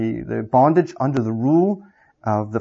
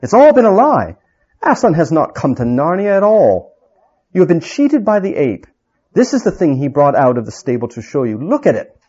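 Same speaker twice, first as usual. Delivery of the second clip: higher, louder urgent proclamation; king exposes the fake lion, raised and insistent; urgent revelation; 4 semitones higher, 4 dB louder than usual. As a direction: reveal the fraud with urgent force.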